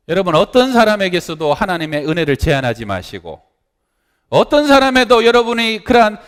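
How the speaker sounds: high, loud, impassioned sermon voice; fervent preaching. Acted. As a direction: preach it hard to the congregation. Preaching at full force.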